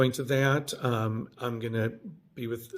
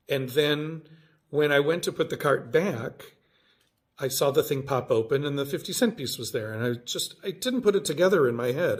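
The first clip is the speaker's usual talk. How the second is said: ordinary talk, nothing marked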